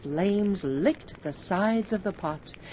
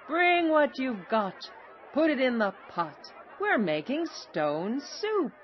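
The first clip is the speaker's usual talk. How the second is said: high call, only mildly raised volume; rallying cry; 7 semitones higher than usual. A narrator's reading. Travelers urging villagers to bring ingredients, crying out. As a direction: call it high, stretching the last line. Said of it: "cried the travelers".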